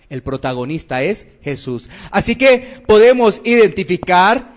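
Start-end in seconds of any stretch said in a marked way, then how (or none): 0.1-1.9 lower, softer "El protagonista es Jesús"; quiet, low repeat for gravity; hushed restatement. Real, not acted. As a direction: drop the voice and let "Jesús" settle. Preacher repeats the point softly.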